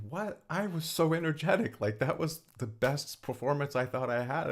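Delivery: mock indignant what, I was so energetic; incredulous protest. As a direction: act out being stunned and defensive. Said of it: "I'm like".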